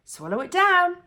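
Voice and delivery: put-on boy hurrying grandma to swallow; eager urging. A narrator's reading. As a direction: push her to gulp it down. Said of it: "George cried".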